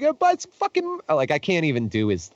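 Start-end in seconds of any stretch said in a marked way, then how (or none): 0.0-1.0 high-pitched French-Canadian heckling, badly imitated; mock a French-Canadian merch seller, pitched way up; attempted French-Canadian accent. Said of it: "I can't do his accent".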